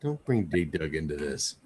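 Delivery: very soft, low movie-drama line; hushed mock-serious plea. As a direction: plead quietly, as if deadly serious. Acted plea in a mock film call.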